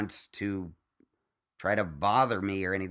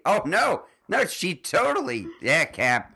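mock referee voice; 10 semitones higher, 8 dB louder than usual. Mocking referee's voice, acted.

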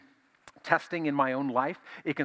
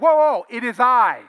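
high, loud, hearty call of reassurance; Jesus calming them; 10 semitones higher, 15 dB louder than usual. Acted. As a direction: loudly settle down frightened men. Jesus calms panicking disciples.

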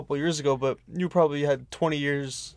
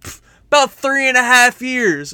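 much higher and louder, puffed-up scoff; boastful drunk lady; 9 semitones higher, 11 dB louder than usual. Retold or imitated. Drunk lady dealer boasting about her years, much higher and louder. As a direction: blurt it out proudly.

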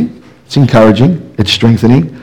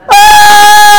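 long, high-pitched demonstration wail; loud crying wail; 4 dB louder than usual. Shown as a demonstration. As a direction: cry out loud and high, holding the note. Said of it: "That's what a loud crying sounds like".

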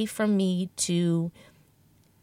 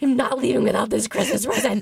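stubborn shopper voice; 3 semitones higher, 5 dB louder than usual. Retold or imitated. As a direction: refuse to budge, laughing at yourself. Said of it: "the shopper in me ... was like".

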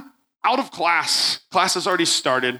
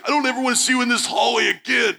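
tough-guy shout; 8 semitones higher than usual. Retold or imitated. Bully telling the narrator to stay out of the hallway, shouted high. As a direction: bark the last word of the fight. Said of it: "he's like".

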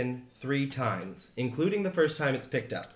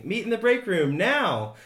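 high, raised excited command; urgent summons; 7 semitones higher, 4 dB louder than usual. Acted. Game master reading out an email to players, urgent summons. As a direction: call everyone in, pitched up.

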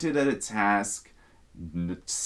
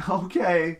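higher, put-on customer-service cheer; happy server voice; 7 semitones higher than usual. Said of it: "puts on the happy server face".

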